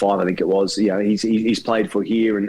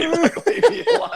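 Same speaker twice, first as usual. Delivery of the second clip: much higher, squeaky, breaking into a laugh; laughing mock whine. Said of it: "sitting in the corner going".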